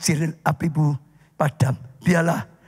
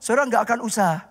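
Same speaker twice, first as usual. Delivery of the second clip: preaching at full force; 9 semitones higher than usual. Acted. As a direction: drive the story home to the congregation. Preaching about the fire within.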